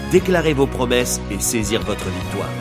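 ordinary talk, nothing marked

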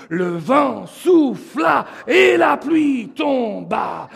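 rhythmic call-outs building in volume and pitch; teaching recitation. Shown as a demonstration. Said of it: "Je vais vous l'apprendre". (déclamé en rythme, de plus en plus fort)

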